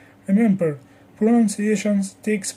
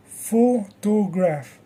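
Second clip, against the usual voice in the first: slow photograph, leaning on photo; slow, measured photograph for clapping along; clapping stress demo; much slower than usual. Shown as a demonstration. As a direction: draw out photograph with the beat on the front, falling at the end. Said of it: "Clap on photo".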